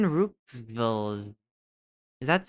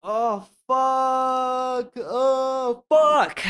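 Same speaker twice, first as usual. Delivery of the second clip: high loud wailing curse; anguished cry; 11 semitones higher, 3 dB louder, much slower than usual. Real, not acted. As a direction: cry out in real despair. Wrong guess frustration cry.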